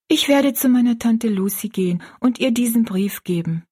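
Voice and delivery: put-on high girlish voice, earnest; young Rosalie, resolved. Rosalie tells her friend her plan, high and earnest.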